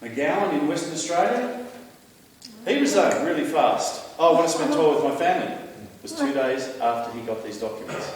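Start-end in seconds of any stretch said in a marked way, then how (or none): 4.2-5.5 mocking quote. Mocking a premier's resignation excuse.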